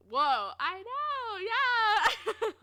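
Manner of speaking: drawn-out, rising cheer; loud, high, stretched-out excitement; excited agreement. Real, not acted. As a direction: stretch it out and whoop at the end. Celebrating the college news with chat.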